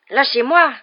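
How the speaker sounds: high, loud furious growl; angry growl. A narrator's reading. Ron held back by friends, furious loud growl. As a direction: struggle free with a loud snarl. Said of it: "grogna Ron".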